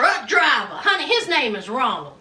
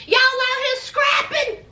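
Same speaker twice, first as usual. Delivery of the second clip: mock shout; 9 semitones higher than usual. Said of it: "Ryan Phillippe is like".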